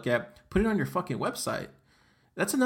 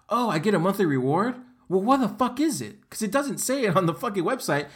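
exasperated outburst; 6 semitones higher than usual. Real, not acted. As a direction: pitch jumps high, voice strained with disbelief. Exasperated rant about missing reward.

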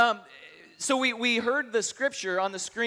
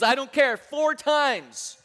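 higher, louder, stubborn insistence; insistent outburst; 6 semitones higher, 5 dB louder, much slower than usual. Acted. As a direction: brush off the warning and push hard. Third guy insists despite warning.

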